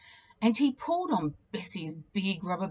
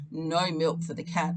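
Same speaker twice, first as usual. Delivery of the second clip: plain reading voice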